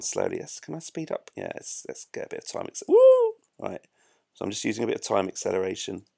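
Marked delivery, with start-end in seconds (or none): 2.9-3.3 very high, loud "woo"; high-pitched joyride whoop; playful whoop. Pretend. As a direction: squeal as things speed up. Whoops as time speeds up.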